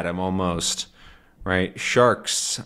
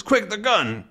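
much higher urgent call; urgent order; 11 semitones higher than usual. Acted. Haddock demanding the gun, urgent. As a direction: snap it out in a hurry. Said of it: "He says".

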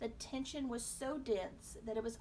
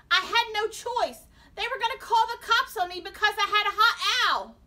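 high, loud whining protest; desperate self-defence; 12 semitones higher, 17 dB louder than usual. A narrator's reading. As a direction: shout your excuse at your parents. Boy defending himself to horrified parents.

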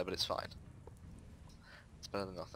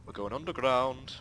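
mock announcer; 3 semitones higher, 3 dB louder than usual. Chanting the adventure line.

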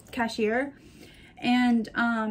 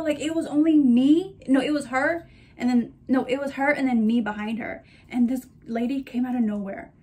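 ordinary talk, nothing marked

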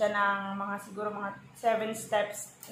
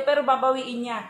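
ordinary delivery

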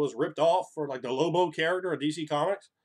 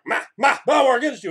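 goblin voice; 10 semitones higher, 11 dB louder than usual. Retold or imitated. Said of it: "the goblins are like".

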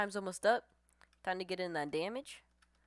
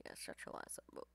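whispered taunt; 15 dB quieter than usual. Acted. Repeats the taunt under his breath.

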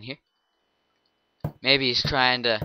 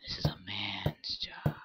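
creepy whisper; 8 semitones lower, 8 dB quieter than usual. Acted. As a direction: hiss it like a stalking killer. Killer whispering in character.

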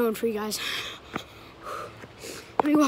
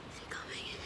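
whispering; 16 dB quieter than usual. Real, not acted. Hiding from a homeowner.

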